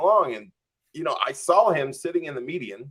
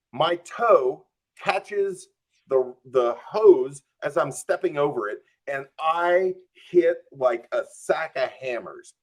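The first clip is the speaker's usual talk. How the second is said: ordinary delivery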